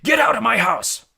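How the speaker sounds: high, loud angry yell; furious. A narrator's reading. Hagrid orders Malfoy out of his house, furious. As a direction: bellow to throw someone out. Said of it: "said Hagrid furiously".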